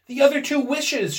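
high, loud and excited; rapid, eager reply. A narrator's reading. Wife reminds husband of the wishes, urgent. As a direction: answer at once, urgently. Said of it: "she replied rapidly".

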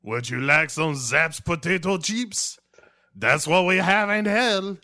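high, loud hammed-up devil; mock devil host. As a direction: offer chips like a cheerful demon. Devil offering chips.

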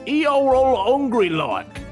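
loud, high, drawn-out mock dialect; hammed-up broad regional English accent. Customer spelling out hunger for owner, broad dialect.

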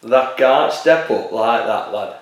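a Barnsley captain scolding his teammate; hammed-up slow Barnsley accent. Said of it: "you just did him from Barnsley".

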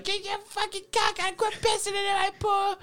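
put-on mother shouting at kids from her trailer window; yelling mom. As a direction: screech it out a window like an angry mom.

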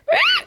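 high dinosaur screech; play-acted dinosaur squawk; mock dinosaur screech. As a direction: let out a silly high T-Rex cry. Acts out dinosaur roar.

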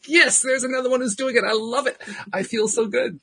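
high, bubbly gush of delight; giddy high-pitched cheer; excited squeal. Retold or imitated. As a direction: squeal it like great news just landed. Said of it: "I was like".

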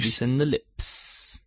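prim precise elocution. Pretend. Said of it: "What was that voice thing".

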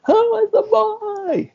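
high, slow panicked wail; high crying panic, drawn out; freaking out. Retold or imitated. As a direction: shriek it out in terror. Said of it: "Angel's freaking out like".

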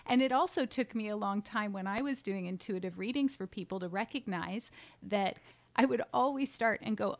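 ordinary talk

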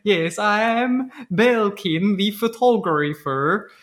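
slow, drawn-out fake European accent; terrible European accent. Impostor introducing himself to security guard, terrible European accent. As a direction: imitate a badly faked European accent, slowly. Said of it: "His accent is like".

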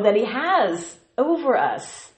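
ordinary delivery

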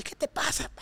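hushed, harsh scold from an angry mum; angry mother hissing at her drunk daughter; mock angry mother. Mother scolding daughter, voiced in story.

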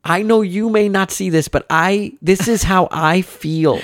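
earnest daughter lecturing parent; kid counselor voice. Retold or imitated. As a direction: impersonate a little girl sounding like a counselor. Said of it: "this kid's like a counselor".

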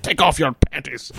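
badly faked Scottish star giving an order; Sean Connery. Retelling a friend's bit, terrible Connery seduction. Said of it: "worst Sean Connery impression".

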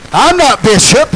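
honouring a bishop, slow and proud; emphatic proclamation. Real, not acted. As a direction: proclaim it slowly to the church.

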